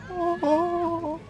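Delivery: very high, dreamy swoon; swooning over a crush's face; swooning sigh. Acted. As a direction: melt into a high lovestruck oh. Swooning over the loved one's face.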